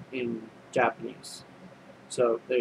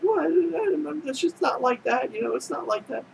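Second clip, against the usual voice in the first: high-pitched mock protest; flustered denial; 14 semitones higher than usual. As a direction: act out an embarrassed, much higher-voiced denial. Flustered denial of teasing question.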